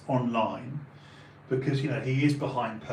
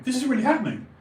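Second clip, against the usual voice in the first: high, loud disbelief; can't believe this is real, voice jumping up; incredulous and emphatic; astonished; 11 semitones higher, 5 dB louder, much faster than usual. Retold or imitated. Retelling his astonished thought.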